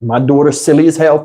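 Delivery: normal talking voice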